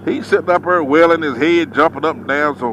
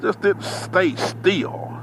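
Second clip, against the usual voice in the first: muttering; 3 semitones higher, 9 dB quieter, slower than usual. Retold or imitated. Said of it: "I'm looking like".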